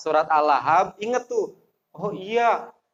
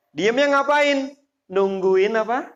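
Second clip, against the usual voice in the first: normal talking voice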